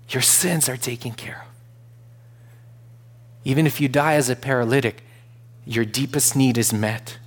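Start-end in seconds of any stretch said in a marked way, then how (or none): 0.1-1.5 whispered reassurance. Retold or imitated. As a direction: whisper it softly. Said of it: "And Jesus says".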